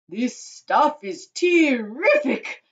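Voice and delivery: slow, loud, high relishing voice; greedy boy. A narrator's reading. Augustus gorging on chocolate, greedy relish. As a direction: savour it, stretching each word.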